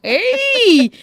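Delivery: laugh, then a high, loud "Hey!"; delighted exclamation. Real, not acted. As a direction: burst out in delight.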